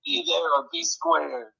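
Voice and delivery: slow, quiet high ad sign-off; monster truck ad. Retold or imitated. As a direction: drop it like an ad tagline. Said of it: "Monster Truck, uh, commercials".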